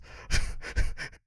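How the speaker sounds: voiceless amused laugh; airy chuckle mid-story. Real, not acted. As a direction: laugh helplessly at the absurdity. Laughs at the absurd scene.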